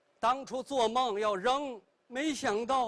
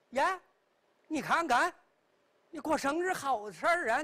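ordinary delivery

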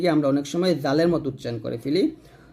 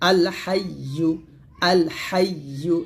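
আল হাইয়ু drawn out, Y clearly sounded; slow model recitation; much slower than usual. Shown as a demonstration. Teacher modelling for pupils how it goes, slow and drawn out. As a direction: stretch আল হাইয়ু slowly and evenly. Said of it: "এরকম".

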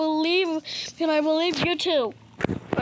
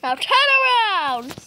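loud, higher furious command; angry parent shout; 6 semitones higher, 6 dB louder than usual. Acted. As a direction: yell it at the child. Parent ordering child.

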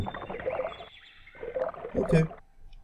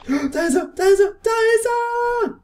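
rising squeals of excitement; excited shout; 4 dB louder than usual. Real, not acted. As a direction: shout it higher each time. Spotting the lake boss fish.

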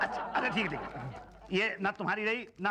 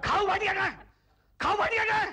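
angry yell; 13 semitones higher, 6 dB louder than usual. Acted. Storming in calling for Bhatia.

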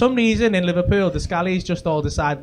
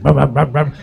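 low, louder imitated scrambler rumble; mock engine revving; 4 semitones lower, 7 dB louder than usual. Scally halfway through his door, engine noise imitation. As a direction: rev the bike at the door. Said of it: "he's like".

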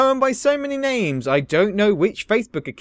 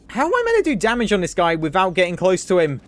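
ordinary delivery

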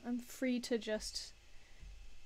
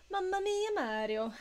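Mario voice; 9 semitones higher, 5 dB louder than usual. Pretend. Mario impression.

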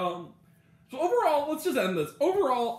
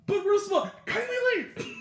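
heckling puppet voice; 13 semitones higher than usual. Retold or imitated. Old Muppet man answering the guards, high mock heckle. Voicing Muppet exchange in retold sketch.

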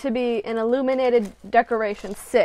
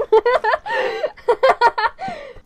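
long, loud, high laughing fit; cackling laughter; 8 dB louder than usual. Real, not acted. Vlogger amused by the craft's hairy look, laughing hard. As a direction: crack up helplessly.

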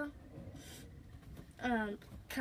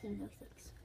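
whispered; 3 semitones lower, 15 dB quieter than usual. Real, not acted. Quiet aside after the vote.

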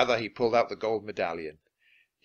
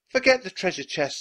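high, louder insistence; urgent order; 8 semitones higher, 5 dB louder than usual. A narrator's reading. Annie urges Jack away from the chest, insistent. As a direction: insist that there is no time.

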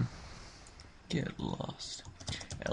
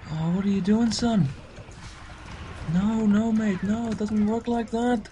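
higher, louder teasing call; excited mock scolding; 9 semitones higher, 5 dB louder than usual. Real, not acted. Gamer mocking an enemy's misplay, loud and high.

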